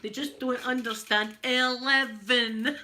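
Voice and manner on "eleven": loud, drawn-out mock exasperation; mental with frustration. Scot not understood by voice control, exasperated. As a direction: stretch it out in imitated frustration. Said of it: "going absolutely mental".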